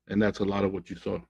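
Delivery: ordinary talk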